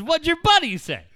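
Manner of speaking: eager question. Retold or imitated. Coworker wanting the buddy's reaction, eager question. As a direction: ask it keenly, as the amused coworker. Acts out the amused coworker asking.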